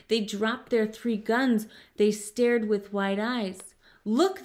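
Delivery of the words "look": high, sudden cry of look; mild shout, pitched up; astonished shout. A narrator's reading. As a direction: call out in amazement. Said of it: "they all shouted".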